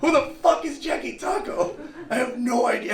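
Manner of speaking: high, loud laughing disbelief; baffled laughing outburst; incredulous. Retold or imitated. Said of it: "I was like".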